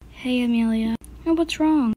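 ordinary talk

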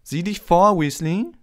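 loud, high taunting warning; high-pitched mocking rival; jeering Malfoy. A narrator's reading. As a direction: threaten him with a sneer. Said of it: "höhnte Malfoy".

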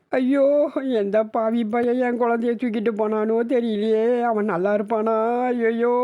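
put-on high keening cry over the lost child; wailing lament. Mother beats her head, wailing curses at kidnappers. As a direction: wail and curse the kidnappers to the heavens. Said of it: "அவள் போட்ட அலறலில்".

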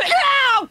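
high, loud ow; sharp yelp of pain. Acted. Wellington tumbling from the tree, sharp yelp. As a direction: yelp as you land.

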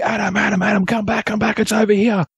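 retold walkie-talkie shout for his mate to come back; urgent call. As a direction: call him back in a hurry, pitch up. Calling friend over walkie-talkie.

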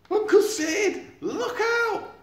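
high, loud panicked yell with a laugh; shouted warning. Acted. Eric warning Sid of the crash ahead, panicked. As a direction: scream the warning.

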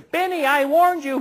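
a high fairy voice delivering the doom; fairy frog mother. Acted. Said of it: "the fairy frog mother appears and goes".

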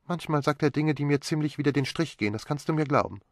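a put-upon complaint, lifted; Magdalene complaining. A narrator's reading.

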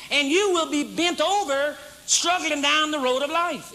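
rising sermon fervor; preaching at full force. Real, not acted. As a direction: drive it home to the congregation.

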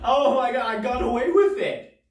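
loud, high-pitched triumphant outburst; thrilled relief. Retold or imitated. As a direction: exult at getting away with a lie. Child thrilled he got away.

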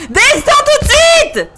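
very high, loud screamed order; impatient yell. A narrator's reading. Monstrous husband ordering his wife down, impatiently. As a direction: scream it out of all patience. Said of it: "s'impatienta le monstre".

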